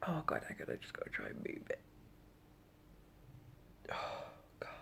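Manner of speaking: whispered, in discomfort; breathy pained whisper; strained whisper. Real, not acted. Straining to move the tube.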